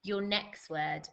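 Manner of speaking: slow, measured word-by-word dictation; spelling-test dictation. Acted. Teacher giving pupils their spelling test, slow dictation. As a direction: read out each test word slowly, with pauses.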